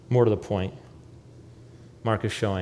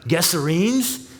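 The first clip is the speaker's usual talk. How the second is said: incredulous; 7 semitones higher, 5 dB louder, slower than usual. Acted. Incredulous at rabbi entering territory.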